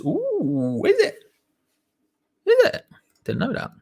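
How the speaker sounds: squeaky high mock surprise; mock amazed. Streamer hams up shock at Montana nukes, high mock surprise. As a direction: shoot way up in pitch, faking shock.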